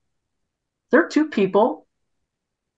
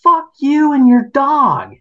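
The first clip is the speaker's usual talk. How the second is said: high, loud furious yell; imitated screaming woman; 10 semitones higher, 4 dB louder than usual. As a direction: scream it at the man walking his dog. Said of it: "jumping and screaming".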